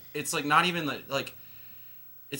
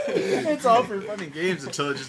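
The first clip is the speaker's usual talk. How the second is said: laughing; 7 semitones higher, 10 dB louder than usual. Real, not acted. Mocking friend's line, laughing.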